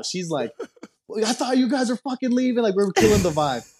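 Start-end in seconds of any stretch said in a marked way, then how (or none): 1.2-3.7 higher, exasperated mock outburst; imitating an annoyed club manager snapping at them; heated manager. Manager Sarah angrily telling him to leave, higher mock outburst. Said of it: "Sarah's heated now".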